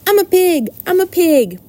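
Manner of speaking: put-on high, loud, gleeful boy shouting; excited yell. A narrator's reading. Boy crawls into the crate playing pig, yelling. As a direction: shout it in a high, playful squeal. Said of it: "yelled Avery".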